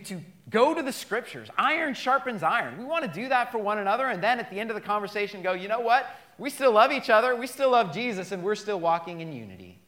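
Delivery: loud, fast, high preacher's build; preaching at full force. Acted. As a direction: press on with rising energy.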